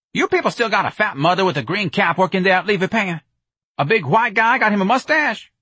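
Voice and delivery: high, loud, colloquial caller; Jones on the telephone. A narrator's reading. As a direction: holler questions down the line. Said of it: "Jones's voice said over the telephone".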